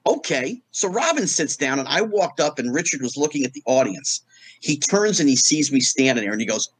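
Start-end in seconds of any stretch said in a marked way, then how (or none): none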